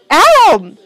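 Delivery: high, loud cry of a name; urgent shouted call. A narrator's reading. Character calls to Adam as he fights Owen, urgent shout. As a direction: call out sharply to get his attention.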